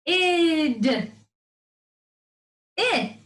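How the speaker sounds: "Id" called out high and stretched; vowel called high. Shown as a demonstration. Teacher demonstrating vowel sound at the top, high. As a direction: lift "Id" high like the coaster's peak. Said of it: "get on your coaster".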